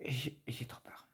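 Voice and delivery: soft whispered fear; frightened whisper. Real, not acted. Wife confessing her fear to husband, whispering. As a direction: barely breathe it, scared.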